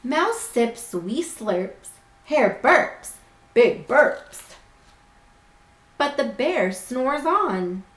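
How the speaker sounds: slow, lilting storybook voice; reading to children. A narrator's reading. Teacher reading a picture book to her class, gently storytelling. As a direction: read it like bedtime story time.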